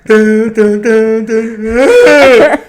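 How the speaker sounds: loud, high wailed piano notes; hammed-up sobbing through a melody; crying while playing. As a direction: bawl the tune note by note. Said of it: "while crying at the same time".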